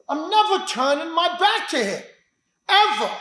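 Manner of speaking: "never" hit hard, "ever" pushed up high; stress on never. Real, not acted. Teacher insisting he'll never turn his back, stress on never. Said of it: "stresses never".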